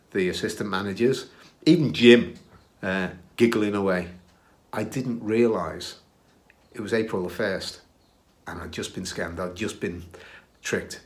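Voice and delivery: ordinary talk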